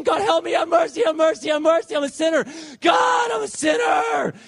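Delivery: high, urgent cry for help; yelled desperate plea. Retold or imitated. Tormented sinner begs God for help in the storm, desperate yelling. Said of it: "I was yelling".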